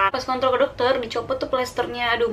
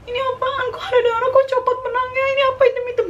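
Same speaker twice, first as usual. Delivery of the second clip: very high, louder, frantic near-crying panic; panicked, near tears; 5 dB louder, faster than usual. Retold or imitated. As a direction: squeal the questions in tearful panic. Reliving panic at the bleeding stitches. (panik setengah menangis, nada sangat tinggi)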